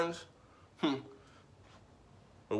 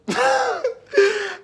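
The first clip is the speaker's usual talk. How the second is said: burst of laughter; 6 dB louder than usual. Real, not acted. Bursts out laughing mid-rant.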